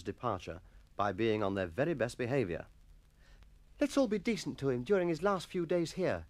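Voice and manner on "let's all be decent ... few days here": put-on higher-pitched boy rallying his mates; urging schoolboy. A narrator's reading. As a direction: urge them on in a boy's voice. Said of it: "Jennings urged".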